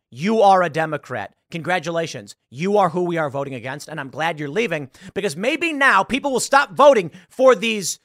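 normal talking voice

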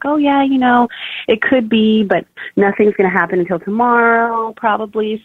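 high, easy-going lilt; imitating unalarmed midwife. Midwife telling first-time mom to wait, high and unalarmed. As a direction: play it down like a calm midwife. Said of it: "she wasn't alarmed".

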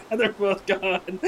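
slow, high, through laughter; laughing aside. Real, not acted. Player laughing as the other characters back away, slow and high. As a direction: giggle through the words.

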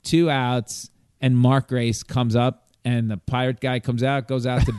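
ordinary talk

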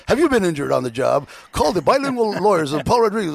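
hammed-up ad read with a laugh in it; lawyer ad. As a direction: pitch it like a bus-bench injury lawyer. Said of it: "had my face on all the buses".

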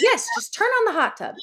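high, emphatic insistence; just do it, no questions; insistent. Retold or imitated. Insisting to confused husband.

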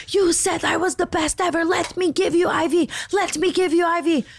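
high, pinched mock yell of a frantic nurse; mock screaming IV nurse. Imitating the IV nurse demanding to treat her client, high mock yell. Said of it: "She's screaming at the top of her lungs".

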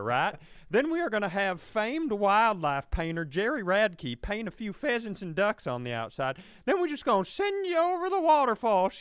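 high, loud hammed-up Southern country doctor; Southern accent. Comedian doing the country doctor's absurd treatment plan, high and loud. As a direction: play the folksy doctor prescribing a barrel ride.

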